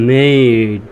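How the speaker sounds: মেইড drawn out slowly on the "ei" glide; long vowel. Shown as a demonstration. Teacher models the word for learners, long-vowel মেইড. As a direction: stretch the middle of মেইড. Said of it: "এর উচ্চারণটা long sound হবে".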